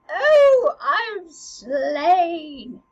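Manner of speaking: loud, hammed-up death cry; Polonius dying. Teacher acting stabbed Polonius for the class, hammed-up dying cry. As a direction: cry out high, then fall away as if slain. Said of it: "Polonius goes".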